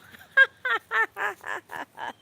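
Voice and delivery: big high cackle, loud; real burst of loud laughter. Real, not acted.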